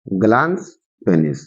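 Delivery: Glans penis low and slow, with a pause between; slow dictation. Shown as a demonstration. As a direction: dictate glans penis one word at a time. Teacher repeats term for students.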